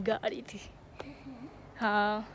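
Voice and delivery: ordinary delivery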